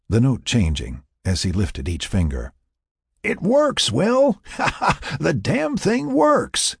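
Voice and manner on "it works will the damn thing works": high, elated cry with laughter; laughing exclamation. A narrator's reading. Jack hearing Will's flute finally play, laughing exclamation. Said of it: "Jack exclaimed, laughing".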